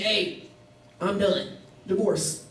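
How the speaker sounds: low, matter-of-fact mock letter reading; blunt dismissal. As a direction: drop the pitch and end it in short pieces. Acting out the divorce letter.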